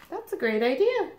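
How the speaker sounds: a playful squeal of delight; mock shriek. Nebraska cheering Kansas's party idea, mild mock shriek. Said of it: "shrieked Nebraska".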